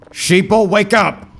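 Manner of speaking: high, loud, slow preacher rant; conspiracy guy voice. Pretend. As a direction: shout it like a doomsday prophet. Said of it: "hooked on the sheeple thing".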